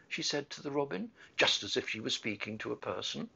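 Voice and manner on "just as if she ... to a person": usual speaking voice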